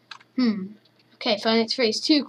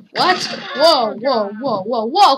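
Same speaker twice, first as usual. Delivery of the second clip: ordinary delivery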